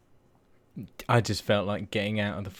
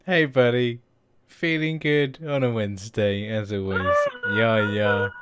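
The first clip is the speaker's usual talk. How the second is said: overly cheery; 3 semitones higher, 7 dB louder than usual. Acted. Mock cheery podcast host reply.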